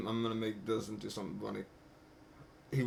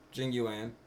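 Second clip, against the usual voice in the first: normal talking voice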